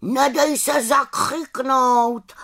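high, slow raven's call; calling after her. A narrator's reading. Raven Abraxas calls after the departing little witch. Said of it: "volal za ní na rozloučenou".

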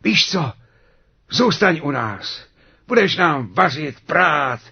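high, loud, emphatic dwarfs; eager invitation. A narrator's reading. Dwarfs invite Sněhurka to stay and keep house, eager. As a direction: offer it brightly, punching each item.

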